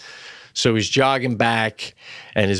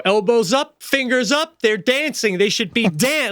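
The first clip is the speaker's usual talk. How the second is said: lightly voiced teacher, higher and brisk; piano teacher instructing; 10 semitones higher than usual. Dad imitating the piano teacher stopping Sadie mid-song. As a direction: coach the fingers up like a strict piano teacher. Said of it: "she'll stop in the middle of a song and go".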